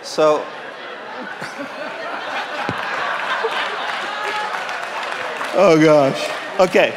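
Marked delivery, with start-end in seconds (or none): 0.5-5.5 high airy burst of laughter; real breathy laugh. Real, not acted. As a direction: laugh helplessly at yourself. Preacher cracks up at own joke.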